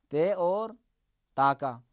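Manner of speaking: ordinary delivery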